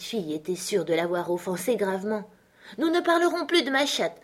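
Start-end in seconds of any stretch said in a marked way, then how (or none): 2.8-4.2 put-on high little-girl promise; placating Alice. Alice promises the offended mouse, high and placating. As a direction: reassure in a high child's voice.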